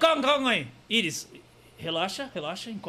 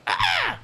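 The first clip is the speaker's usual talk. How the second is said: high sharp crow caw; crow squawk; 5 dB louder than usual. Pretend. [name] triggered into crow, loud high squawk.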